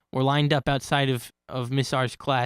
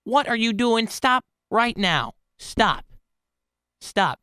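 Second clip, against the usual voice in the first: stern teacher; 7 semitones higher, 4 dB louder, slower than usual. Retold or imitated. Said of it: "looking at him like".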